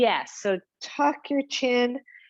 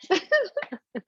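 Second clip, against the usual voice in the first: laugh; 10 semitones higher than usual. Real, not acted. Laughs after "just for fun.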